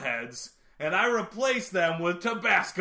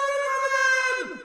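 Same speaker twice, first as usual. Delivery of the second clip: announcer shout. Acted. Announcing a segment title.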